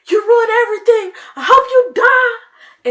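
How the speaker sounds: high, loud tearful yell; imitated tantrum scream. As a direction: scream it like a betrayed kid brother. Brother screams at sister after tackle.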